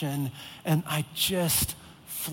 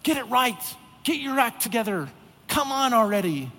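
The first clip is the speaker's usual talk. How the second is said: higher, nagging hurry-up scold; impatient scolding; 6 semitones higher than usual. Retold or imitated. An imagined frustrated God scolding believers, impatient and nagging. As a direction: push them like an exasperated boss. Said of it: "constantly frustrated with us and ready to fly off the handle".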